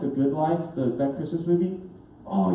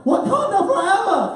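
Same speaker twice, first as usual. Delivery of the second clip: high, triumphant movie cry; jubilant cheer; 13 semitones higher, 4 dB louder than usual. Retold or imitated. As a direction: call it out, elated and laughing.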